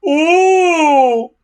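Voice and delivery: high, loud howl of pain. A narrator's reading. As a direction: howl as if bitten. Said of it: "howled Dog's Breath".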